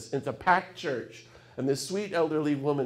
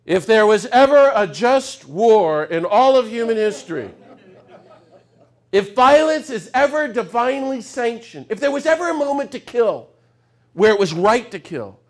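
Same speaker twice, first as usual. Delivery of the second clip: Peter's zealous thoughts; 7 semitones higher, 9 dB louder than usual. Retold or imitated. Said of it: "And Peter's thinking".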